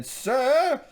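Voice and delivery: high, loud mock-indignant cry; pissed-off shout. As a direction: call out sharply in mock outrage. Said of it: "Chichester is pissed off".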